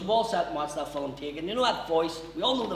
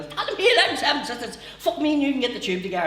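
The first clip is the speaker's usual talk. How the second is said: put-on Newry accent; 8 semitones higher, 4 dB louder, faster than usual. Newry man voiced.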